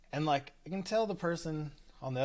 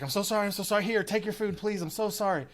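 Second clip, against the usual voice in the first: high, hurried string of apologies; flustered apology; 8 semitones higher, faster than usual. Retold or imitated. Manager handing food to wronged customer, apologizing frantically. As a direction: imitate a manager desperately placating.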